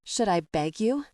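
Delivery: plain reading voice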